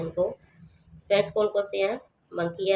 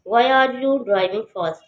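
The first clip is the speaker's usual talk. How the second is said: slow, louder wh-question falling at fast; falling tune; 4 semitones higher, 6 dB louder, much slower than usual. Shown as a demonstration. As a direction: start high and fall. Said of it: "ये भी falling है".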